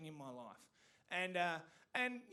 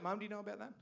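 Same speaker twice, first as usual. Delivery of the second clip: normal talking voice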